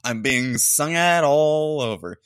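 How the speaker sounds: loud, drawn-out mimicry; mocking imitation. Host mocking the woman being sung at, drawn-out mimicry. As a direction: stretch it out loudly in mock imitation. Mockingly repeats clip through laughter.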